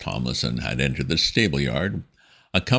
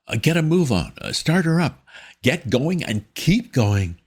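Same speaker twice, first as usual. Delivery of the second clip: put-on flustered young gentleman; 12 semitones higher, 4 dB louder than usual. A narrator's reading. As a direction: stammer like a rattled young gent. Wooster voiced in story.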